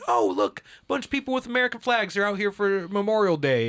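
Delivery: high, loud, mock-cheerful naive voice; naive bystander. Speaker mocking the naive average person, high cheerful voice. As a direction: gush like someone who doesn't get it.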